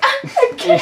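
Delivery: high, laughing fake sob; mock crying. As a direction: pretend to break down crying. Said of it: "What if I just cried".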